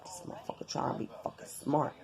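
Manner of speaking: low, hushed, quick angry grumble; irritated private mutter, barely voiced; heated muttering. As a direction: grumble it under your breath, retelling your irritation. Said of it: "I was heated".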